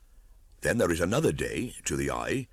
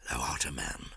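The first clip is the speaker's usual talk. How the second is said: dying whisper; 3 semitones lower, 6 dB quieter than usual. A narrator's reading. Dying Akela fading.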